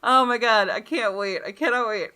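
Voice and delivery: ordinary delivery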